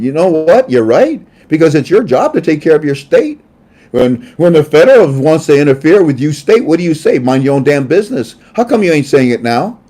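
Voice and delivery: loud, high, indignant challenge; heated rant. Real, not acted. Host ranting at governors about states' rights, loud. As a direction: rail at them loudly.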